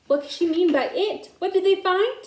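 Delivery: usual speaking voice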